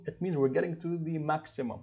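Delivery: ordinary talk, nothing marked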